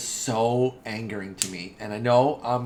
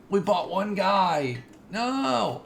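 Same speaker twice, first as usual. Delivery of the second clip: high, loud, drawn-out cry of dismay; long high "No!" of frustration; anguished wail; 8 semitones higher, 4 dB louder, much slower than usual. Real, not acted. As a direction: wail it in protest. Hiccups ruin the stream.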